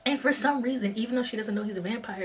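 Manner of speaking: usual speaking voice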